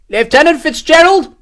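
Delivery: high, loud searching call; shouting. A narrator's reading. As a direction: shout for your lieutenant through the trees. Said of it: "he shouted".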